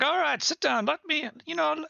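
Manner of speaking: very high, welcoming merchant voice. Retold or imitated. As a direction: squeak it out like an eager trader. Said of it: "He's like".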